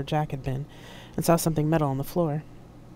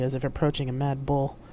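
usual speaking voice

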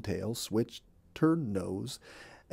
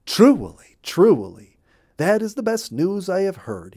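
delighted; 8 semitones higher, 7 dB louder than usual. Acted. Bluebird delighted.

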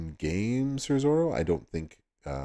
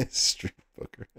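laughing; 6 semitones higher, slower than usual. Real, not acted. Laughing repeat of chat name.

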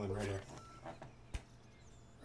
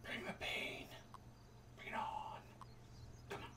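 whisper; 14 dB quieter than usual. Acted. Taunting game enemies.

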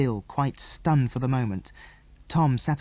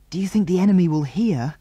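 higher-pitched, worried question; anxious; 5 semitones higher, 3 dB louder than usual. A narrator's reading. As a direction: ask with a touch of worry. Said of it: "asked Jill anxiously".

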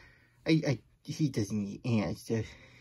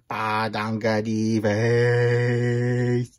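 slow, loud, lower nonsense; silly nonsense voice; 3 semitones lower, 7 dB louder, much slower than usual. Acted. Reader saying nonsense from the book, slow and loud. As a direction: drag out the silly words. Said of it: "make silly sounds like".

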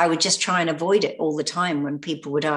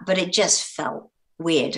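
ordinary talk, nothing marked

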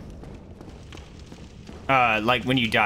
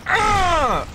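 startled scream; 5 dB louder than usual. Real, not acted. Scream during fight.

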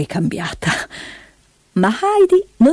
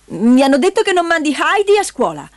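high, scolding woman's voice; high-pitched indignation; accusing aunt; 8 semitones higher than usual. A narrator's reading. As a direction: reproach him sternly. Aunt accuses the old man.

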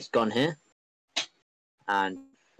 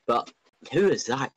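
ordinary talk

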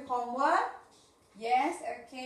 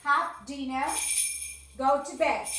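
repetition drill; 2 semitones higher, slower than usual. Shown as a demonstration. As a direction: lead the class through the list. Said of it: "we have repetition now". (la maestra dirige la repetición en voz alta)

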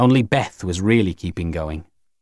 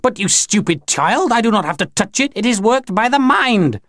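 high, scornful alien voice; puzzled, scornful Grinny; 11 semitones higher than usual. A narrator's reading. Grinny puts a stupid child right, high and scornful. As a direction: climb high to a drawn last word. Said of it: "looked surprised and puzzled and replied".

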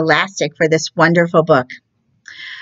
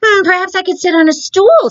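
much higher, wheedling frog voice; hopeful frog; 14 semitones higher, 4 dB louder than usual. Acted. Frog suggests a stool to the cat, high hopeful voice. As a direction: float the idea hopefully, high and cartoonish.

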